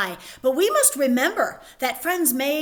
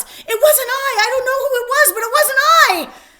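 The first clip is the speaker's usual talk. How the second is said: much higher, loud frantic protest; panicked denial; 12 semitones higher, 9 dB louder than usual. Acted. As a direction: squeal your innocence. Soldiers accusing each other.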